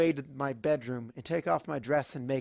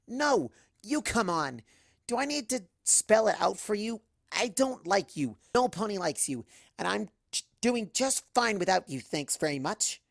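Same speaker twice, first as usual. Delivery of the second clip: angry; 7 semitones higher than usual. Acted. Angry refusal through door.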